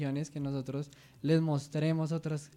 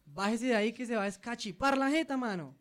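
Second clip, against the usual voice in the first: high Santander warning; mock Santander accent, raised; 8 semitones higher than usual. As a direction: scold in Santander slang, pitched up. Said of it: "Se dice".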